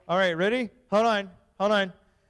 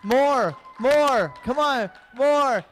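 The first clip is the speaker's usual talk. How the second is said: louder, higher calls for more; egging on applause; 6 semitones higher, 5 dB louder, much slower than usual. Acted. Pastor begging the crowd for more cheers, loud and eager. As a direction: pump the crowd up. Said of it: "When I say come on, that means more".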